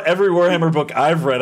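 ordinary talk, nothing marked

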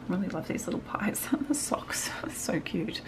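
soft whispered aside about cute pies. Real, not acted. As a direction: hush it as if sharing a secret.

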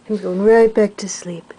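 usual speaking voice

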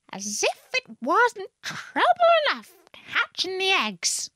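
high, put-on bird whine; exasperated. A narrator's reading. Pigeon moaning about its eggs, exasperated. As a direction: squawk the grievance high.

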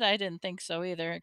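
ordinary delivery

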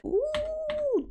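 very high, impressed ooh; delighted ooh. Real, not acted. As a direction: coo in delight. Sees an award.

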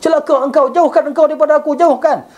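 a man pleading while he cries, half-acted; tearful plea. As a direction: beg him away as if weeping. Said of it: "faja'al Atha yabki".

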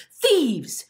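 loud, high cry of alarm; shouting. A narrator's reading. Ellen accuses the boys, loud shout. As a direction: shout it out strong. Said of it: "she could hear herself shouting".

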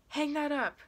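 loud, high-pitched yell; yelled order. A narrator's reading. Gunman orders narrator off the phone, loud high yell. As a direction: yell the command sharply. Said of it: "Frosty yells at me".

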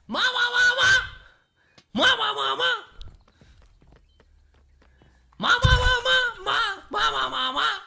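loud, high-pitched fake wailing; mock a crybaby with shrieked sobs; high, pulsing pretend bawling; mock crying. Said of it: "te gusta mucho llorar".

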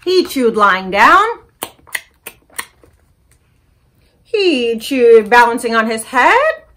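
put-on lively picture-book read-aloud for kids; story time reading. A narrator's reading. Librarian reading a story to children, animated. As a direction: read it bright and animated to little listeners.

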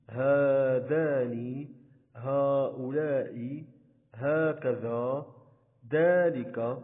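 each of هذا، هذه، هذان، هؤلاء، هكذا، ذلك، أولئك with the long aa held; slow list, stretching every long aa from هذا to أولئك; unwritten alif sounded. Shown as a demonstration. Teacher listing demonstratives, the hidden alif in words like هذا drawn out. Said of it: "نطقنا الألف ولكن لم نكتبها".

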